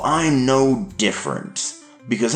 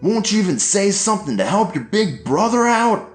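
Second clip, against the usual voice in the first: shouting dad; 12 semitones higher than usual. A narrator's reading. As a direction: shout at her while hitting him.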